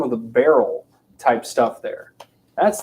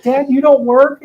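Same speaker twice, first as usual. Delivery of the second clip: put-on much higher, slow child voice; high hammed-up kid; mock kid; 12 semitones higher, 6 dB louder than usual. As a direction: ask like a shocked kid. Kid asking.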